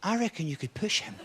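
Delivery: soft, sinister murmur; evil inner voice. Acted. His brain eyeing a man at the platform edge, evil murmur. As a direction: quietly tempt like the evil part of the brain. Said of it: "a part of your brain goes".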